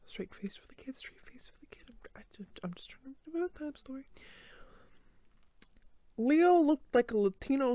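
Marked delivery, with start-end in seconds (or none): 0.1-4.1 whispering to herself to keep a straight face; hushed self-reminder, barely voiced; whispered aside. Real, not acted. Reader steadying herself for the kid, whispering.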